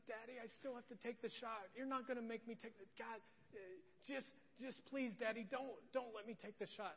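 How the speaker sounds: put-on high, halting little-girl plea; pleading child. Daughter begging dad to skip the shot, imitated pleading. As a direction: beg like a frightened small child. Scared toddler begging her father.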